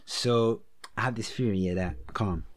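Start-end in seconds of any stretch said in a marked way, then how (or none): none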